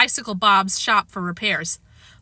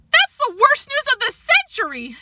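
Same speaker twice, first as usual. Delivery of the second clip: high dramatic wail; Sheila crying out. A narrator's reading. Sheila hearing Jimmy is coming, high dramatic outcry. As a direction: wail it like a put-out girl. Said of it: "Sheila cried".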